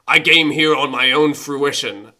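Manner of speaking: loud, indignant-sounding turtle voice; talking turtle. Acted. Turtle answers the man in the sewer, in a loud creature voice. Turtle talks back in story.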